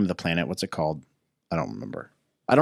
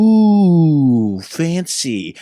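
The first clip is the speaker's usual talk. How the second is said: long, high, loud "ooh"; exaggerated awe; mock impressed; 8 semitones higher, 8 dB louder, much slower than usual. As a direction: play up being wowed, drawing out the ooh. Mock awe at fantasy references.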